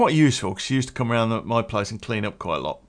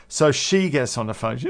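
usual speaking voice

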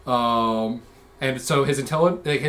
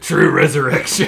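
pro wrestler voice; 6 dB louder than usual. Acted. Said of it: "a wizard character who talks like a pro wrestler".

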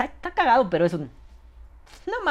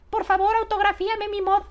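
very high pleading fan voice; 14 semitones higher than usual. Retold or imitated. Fan begging him to sign a vape mod, high pleading voice. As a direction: beg like an eager fan.

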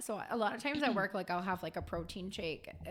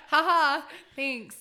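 loud fake laugh, then curt thanks; sarcastic thanks; 6 semitones higher, 8 dB louder, much slower than usual. Server replying to a customer's cocaine joke, sarcastic fake laugh. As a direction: humour the joker with mock amusement.

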